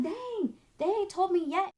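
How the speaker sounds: high, disbelieving "dang" said to herself; a retold sigh of disappointment, voiced high; mock dismay. Retelling her worried thought at Christmas.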